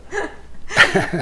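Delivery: high unvoiced laugh; real laugh at the joke; burst of laughter. Real, not acted. Reacting to joke.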